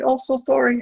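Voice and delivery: high sing-song apology; breezy apologetic driver voice; imitating a polite Canadian. Imitating an apologizing Canadian driver, high polite. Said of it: "put their hand up and say".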